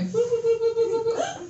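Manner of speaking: high beeping boops climbing in pitch; rising alarm beeps. Acted. Comic beeping as a date walks in, alarm beeps. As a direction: imitate warning beeps going off one after another. Red flags going off on date.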